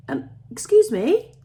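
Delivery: timidly interrupt, voice lifted; tentative. A narrator's reading. Girl interrupting two miners from the shadows, tentatively. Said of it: "Cherry said tentatively".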